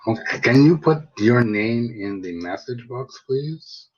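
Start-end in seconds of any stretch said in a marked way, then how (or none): none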